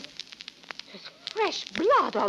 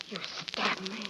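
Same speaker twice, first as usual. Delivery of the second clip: faint dying voice; 5 semitones lower, 10 dB quieter than usual. Acted. Dying words.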